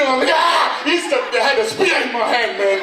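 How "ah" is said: high panicked yelp from a fright; startled scream. Retold or imitated. Comic immigrant jolted by an automatic door, high startled scream. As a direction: shriek like the door just attacked you.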